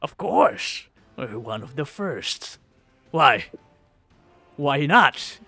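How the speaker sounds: proud mercenary captain, puffed up; boastful hobgoblin captain. Acted. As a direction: brag about the Red Hand.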